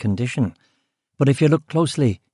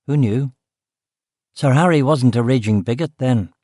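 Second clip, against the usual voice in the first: ordinary delivery, nothing marked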